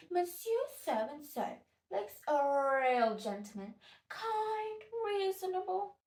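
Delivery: high, sing-song mock praise; swooping, drawn-out admiration, put on; gushing admirer. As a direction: mimic society folk cooing over a gentleman. Mimics admirers of a husband.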